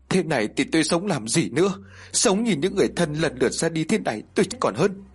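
grandmother sobbing that she'd rather die. Acted. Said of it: "bà Dạng nức nở nói".

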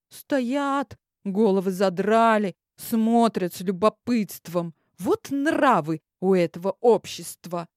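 ordinary delivery, nothing marked

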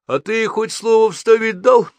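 high, slow, mock-innocent question; loud, drawn-out show of innocence; innocently asking. A narrator's reading. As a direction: needle him with feigned innocence. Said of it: "Невинно поинтересовался Лев Иванович".

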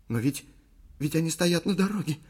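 mildly trembling, halting fear; falter, then pitch up in fright; teeth chattering. A narrator's reading. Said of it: "стучала зубами Ли". (испуганно, стуча зубами)

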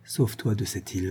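a soft, frightened rasp, dropping away; dying father urges his daughter to flee, softly terrified; hoarse and terrified. A narrator's reading. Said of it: "d'une voix rauque et terrifiée".

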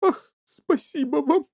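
slow, high, relieved thanks; grateful gasp. A narrator's reading. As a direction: thank him with drawn-out feeling. Tearful mother thanks Wonka for hope.

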